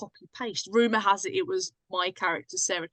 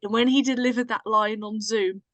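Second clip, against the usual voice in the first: ordinary talk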